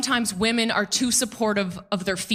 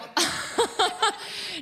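high laugh; 10 semitones higher than usual. Real, not acted. Laughs at own remark.

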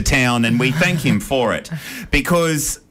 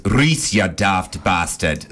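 put-on Welsh accent; 5 semitones lower, much slower than usual. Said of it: "Can you say that in your Welsh accent again?".

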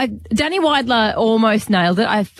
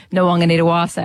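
Nwang'anitawase run together as one word; put together faster. Shown as a demonstration. Co-host shows the learner where to get to, joined up and faster. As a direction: roll through the whole name fluently. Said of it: "putting it together faster".

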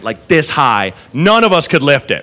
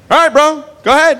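much higher, sarcastic go-ahead; mock encouraging; 9 semitones higher, faster than usual. Food runner sarcastically lets his boss try, higher mock cheer. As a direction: egg him on with fake confidence. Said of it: "So I was like".

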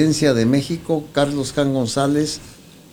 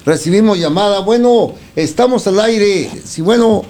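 higher and louder, calling down the line; radio host hailing a caller, raised voice; loud on-air phone greeting; 8 semitones higher, 5 dB louder than usual. Real, not acted. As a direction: answer the phone loud and bright for the broadcast.

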